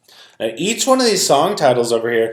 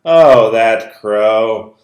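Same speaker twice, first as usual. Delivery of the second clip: slow, drawn-out, louder; storyteller delighting in the crow, slow; slow fond remark; 6 dB louder, much slower than usual. Acted. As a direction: linger on each word. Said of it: "fond drawn-out remark".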